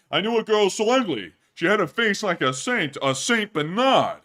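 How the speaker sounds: high and loud, punching the one-liner; loud stand-up comic. Pretend. Streamer retelling the ugly-girl joke as a loud comic. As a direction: sell the joke like a nightclub comic.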